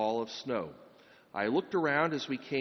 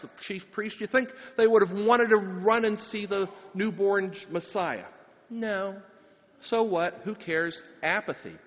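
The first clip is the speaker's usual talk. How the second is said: higher, louder impassioned preaching; forceful preaching; 6 semitones higher, 4 dB louder than usual. Acted. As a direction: rouse the congregation against apathy. Preaches against apathy and Herod.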